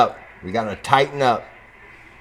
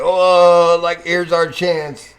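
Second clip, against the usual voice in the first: loud, raised, drawn-out mock excitement; mock eager computer; 4 semitones higher, 6 dB louder, much slower than usual. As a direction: imitate the computer seizing its chance, stretched out. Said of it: "just 'cause it's like".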